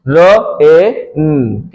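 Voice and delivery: drawn out, sound by sound; showing a child how to read रेन; slow sounding out. Shown as a demonstration. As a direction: blend र ए न very slowly. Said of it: "आर को र पढ़ना है".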